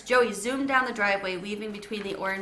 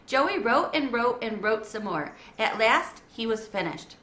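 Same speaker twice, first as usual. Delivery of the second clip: plain reading voice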